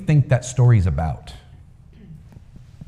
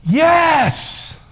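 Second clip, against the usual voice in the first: crowd shout. A narrator's reading.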